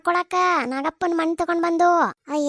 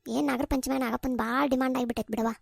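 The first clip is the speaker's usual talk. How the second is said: ordinary delivery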